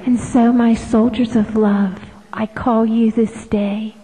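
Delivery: unvoiced, hushed solemn call; prayerful whisper. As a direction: whisper it like a sacred summons.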